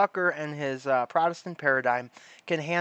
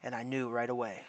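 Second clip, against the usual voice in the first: noir detective; 4 semitones lower than usual. Pretend. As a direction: brood like a tired gumshoe. Mocking a noir detective monologue.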